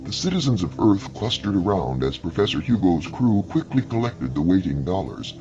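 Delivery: plain reading voice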